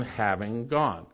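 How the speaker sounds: having gone drawn out slowly and quietly; slow and soft. Shown as a demonstration. Preacher contrasting with go, having gone said slow and soft. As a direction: let having gone sag, no command in it. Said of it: "rather than".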